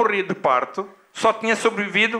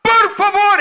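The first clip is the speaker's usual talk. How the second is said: high, loud shouted plea; desperate shouting; 12 semitones higher, 7 dB louder than usual. Acted. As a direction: cry out to passers-by for help. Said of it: "Ele vinha a gritar". (súplica aos gritos)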